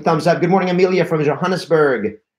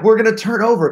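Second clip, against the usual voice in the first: high-pitched alarmed outburst; retold panic, pitched well up; freaking out; 9 semitones higher, 4 dB louder, faster than usual. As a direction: blurt it in a mock panic. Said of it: "I'm freaking out".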